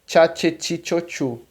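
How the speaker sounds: "Tcha, tche, ti, cho, chu" all with a TCH onset; "ti" said as "tchi" within the tch drill; tch syllable drill. Shown as a demonstration. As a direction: recite the tch series through the vowels. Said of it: "som de T C H".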